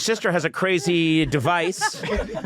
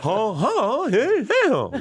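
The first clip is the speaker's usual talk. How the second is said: high-pitched comic impression, taped mouth; wordless squealing hostage protest; Seinfeld; slower than usual. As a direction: yelp sing-song syllables as a gagged comedian. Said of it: "Seinfeld being held hostage".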